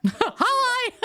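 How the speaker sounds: high-pitched giggly hello; laughing greeting. Pretend. Player introduces their character to another, high laughing greeting. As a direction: squeal the greeting and dissolve into laughter.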